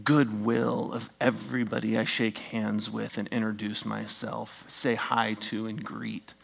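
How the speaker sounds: ordinary delivery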